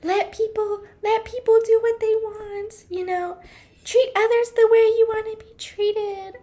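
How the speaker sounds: high, sing-song parody of wellness advice; mocking preachy advice. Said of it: "so tired of that".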